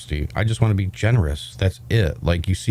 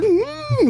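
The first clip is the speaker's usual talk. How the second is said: high clown hum. Pretend. Clown bit continues.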